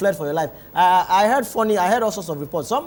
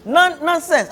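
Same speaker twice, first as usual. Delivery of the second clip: high, louder mock outburst of disapproval; imitated scolding father; 9 semitones higher, 5 dB louder, slower than usual. Father correcting children's Bible reading, mock indignant scolding. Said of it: "my father would say".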